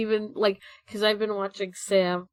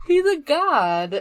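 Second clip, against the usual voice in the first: higher, slow and laughing; 5 semitones higher, 8 dB louder, slower than usual. Real, not acted. Joking with friends about Zagreus, laughing. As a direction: crack up on the line. Laughing at Super Saiyan joke.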